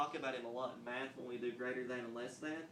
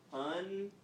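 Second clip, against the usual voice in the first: UN... DER... DOG, with long gaps; syllable by syllable; 6 semitones higher, 4 dB louder than usual. Shown as a demonstration. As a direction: stretch "Underdog" into three isolated, higher-pitched syllables. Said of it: "let's just work on clapping 'em out".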